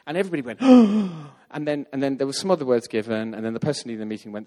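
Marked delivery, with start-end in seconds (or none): 0.6-1.4 sharp loud collective gasp. Retold or imitated. The room reacting to the word, loud astonished gasp.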